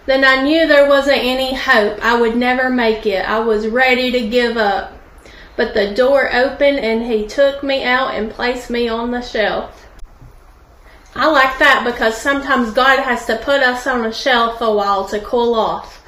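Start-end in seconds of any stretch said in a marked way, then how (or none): none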